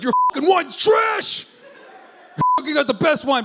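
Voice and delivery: hammed-up yell of outrage; mock shout. Comic imitating any other nation trashing French wine, mock shouting. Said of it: "anybody else would be like".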